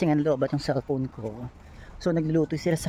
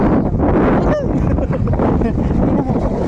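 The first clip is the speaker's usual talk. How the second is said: big high burst of laughter; a loud, high laugh at her own nerves; 7 semitones higher, 7 dB louder than usual. Real, not acted. Laughing at the scary deep sea.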